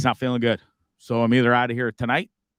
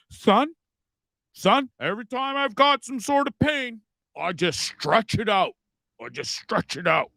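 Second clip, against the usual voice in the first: higher-pitched, drawn-out fatherly advice; imitating his dad; 10 semitones higher than usual. Host imitates his dad advising his son, fatherly impression. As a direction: put on Dad's stubborn voice, stretching the words. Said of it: "how come your dad doesn't have a British accent".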